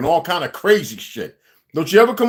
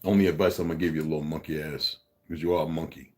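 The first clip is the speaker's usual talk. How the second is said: very low, hushed put-down; quiet insult; 12 dB quieter than usual. Real, not acted. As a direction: deliver the jab softly, way down in pitch. Parting insult to the challenger.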